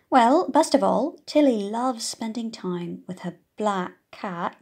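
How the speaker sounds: usual speaking voice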